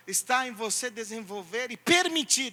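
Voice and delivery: ordinary talk, nothing marked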